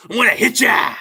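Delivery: playful mock shout; hammed-up tough-guy yell; mock threat. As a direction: bark a fake threat at the viewers. Joking threat to viewers.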